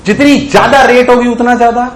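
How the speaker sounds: normal talking voice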